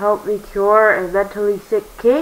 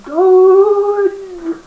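S=dun; dramatic dun; 11 semitones higher, 4 dB louder than usual. Acted. Dramatic sound-effect intro.

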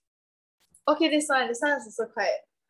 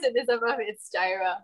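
usual speaking voice

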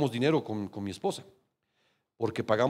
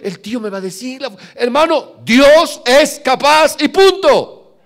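full-force preaching; 12 semitones higher, 12 dB louder than usual. Real, not acted. Pastor thundering at the congregation that God is able.

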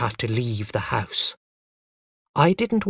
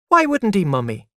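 put-on high, louder child voice falling away; child's insistent question; 7 semitones higher, 4 dB louder than usual. A narrator's reading. Little boy questions mother at bedtime, high and insistent. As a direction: press like a puzzled little boy.

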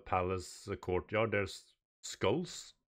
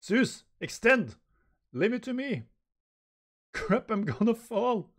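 high, loud frantic cries; panicking hedgehog; 13 semitones higher, 6 dB louder than usual. Acted. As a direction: yell it out mid-action, high-pitched. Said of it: "uh, Hedgehog goes".

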